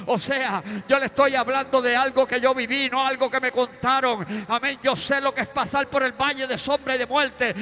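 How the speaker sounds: loud, rising preacher's cry to the congregation; full-force preaching. Acted. Preacher proclaiming God's promise of healing to the church, loud and rising.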